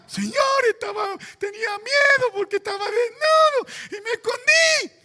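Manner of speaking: very high, loud mock wail; Adam's frightened confession. Adam confessing to God why he hid, loud exaggerated wail. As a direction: cry out like a panicked, hammed-up Adam. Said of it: "eso es lo que dice Adán".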